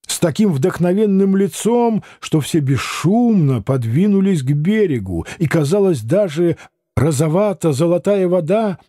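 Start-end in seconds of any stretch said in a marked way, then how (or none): none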